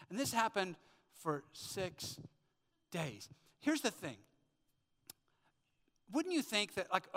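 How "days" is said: ordinary delivery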